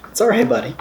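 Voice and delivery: high, sing-song comfort for a pet; calm the startled dog; reassuring the dog. Real, not acted. Soothing his dog Hank.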